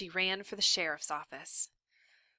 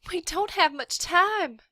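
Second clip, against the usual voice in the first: much higher, loud pressing plea; urging; 11 semitones higher, 8 dB louder than usual. A narrator's reading. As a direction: hurry him along. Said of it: "urged Catherine".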